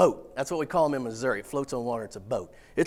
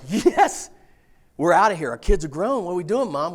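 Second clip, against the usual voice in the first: much higher and louder, celebrating; jubilant; 5 semitones higher, 7 dB louder than usual. Retold or imitated. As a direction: cheer like you just got freed from the minivan. Car wrecked, jubilant to wife.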